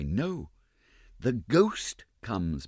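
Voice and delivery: plain reading voice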